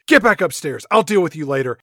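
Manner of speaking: loud mock scold; a party mom ordering her daughter upstairs; mom's stern order. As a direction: bark the order at the drunk daughter. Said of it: "Dawn's party mom goes".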